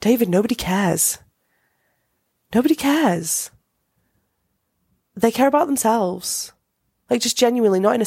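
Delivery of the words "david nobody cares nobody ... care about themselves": lightly voiced breezy sister, higher; imitating Alexis. Sister brushes off David's driving test fears, light and breezy. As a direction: toss it off like a carefree sister. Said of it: "she just turns to him and she says".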